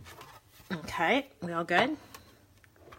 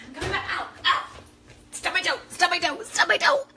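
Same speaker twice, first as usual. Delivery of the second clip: stubbed toe; 11 semitones higher than usual. Real, not acted. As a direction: yelp in real pain while laughing. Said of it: "totally just stubbed my toe".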